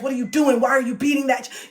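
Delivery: put-on a bystander scolding a parent who hits a child, shouting; mock yell at a parent. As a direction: yell at them like you just caught them. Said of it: "You would try to yell at the parents".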